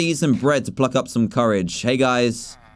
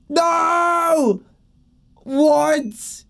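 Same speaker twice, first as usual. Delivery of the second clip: high anguished shout of no; shocked cry; 11 semitones higher than usual. Real, not acted. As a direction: cry out as the friend dies. Friend blown up onscreen.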